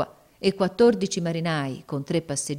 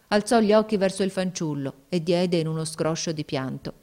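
usual speaking voice

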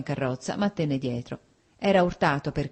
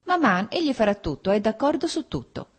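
a touch of pique, pitched up; understated annoyance in a higher voice; mildly vexed; 6 semitones higher, 3 dB louder than usual. A narrator's reading. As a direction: protest to mother with mild irritation. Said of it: "disse Kitty con stizza".

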